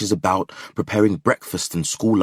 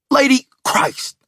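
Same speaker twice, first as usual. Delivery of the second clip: pained cry; 6 dB louder, slower than usual. Acted. Burned by steam.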